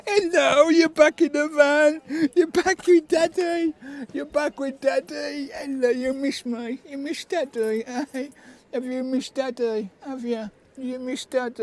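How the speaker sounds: high sing-song voice for the dog. Real, not acted. Reunited with his dog, baby talk.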